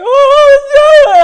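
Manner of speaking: high, loud mock wail; babbling wail. Retelling the woman's reply on the call, mock babbling wail. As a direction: imitate an overwrought wailing cry. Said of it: "she's babbling right now".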